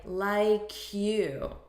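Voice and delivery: "like you" blended as "lie-kyou"; linked sounds. Shown as a demonstration. Teacher showing what it sounds like, "like you" linked. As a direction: move the K of "like" onto "you". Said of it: "it actually sounds like".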